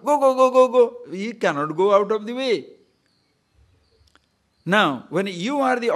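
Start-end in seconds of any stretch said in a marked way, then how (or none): 0.1-0.8 louder, higher pretend yell; mock shout. Teacher acting a rower shouting go at a boat, mock shout. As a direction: bark the go's at the empty boat. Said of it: "You don't shout".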